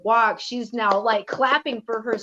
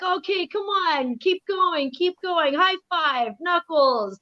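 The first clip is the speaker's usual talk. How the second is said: much higher sing-song encouragement; cheering on; 11 semitones higher than usual. Retold or imitated. Auntie urging her crying niece to keep going, high and excited. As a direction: hype a crying toddler back up.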